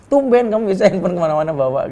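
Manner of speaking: mock her excuse with loud scorn; sarcastic anger. Husband sarcastic to wife. (sindiran marah, tinggi dan keras)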